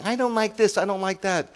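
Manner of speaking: high whiny mock complaint, imitating a griping church member; mocking complainer. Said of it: "Not saying".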